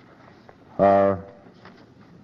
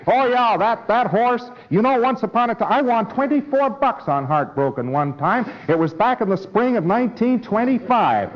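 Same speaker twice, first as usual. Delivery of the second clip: much higher, brighter-pitched eager gambler; eager reminiscing; 9 semitones higher than usual. Acted. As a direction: rattle it off like a thrilled racing fan. Preclear eagerly recalls horse win.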